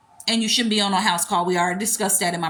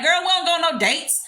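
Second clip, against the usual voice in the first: put-on high-pitched, offhand girl-talk reply; dismissive girlfriend; 8 semitones higher than usual. Woman answering the commenters about date night, breezily dismissive. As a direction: brush the question off in a raised voice. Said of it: "if I said to y'all".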